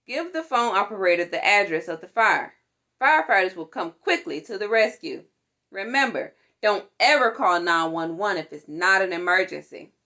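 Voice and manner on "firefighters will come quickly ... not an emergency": usual speaking voice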